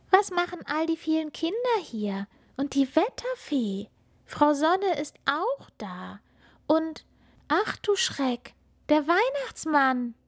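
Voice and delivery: put-on high, wondering child voice; a tiny astonished voice; little star child. Funkila seeing everyone at the rainbow, high and astonished.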